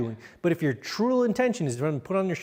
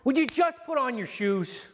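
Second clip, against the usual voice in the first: higher, fed-up plea; exasperated plea; 7 semitones higher than usual. Shown as a demonstration. Coach playing a parent fed up over the shoes, exasperated. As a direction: finally snap in exasperation. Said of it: "that's a great one".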